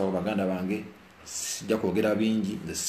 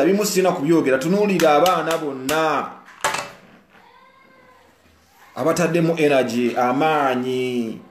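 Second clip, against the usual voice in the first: loud, high, forceful preaching; fired-up rant to viewers; impassioned shout; 8 semitones higher, 9 dB louder than usual. Real, not acted. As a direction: shout it out with fervor.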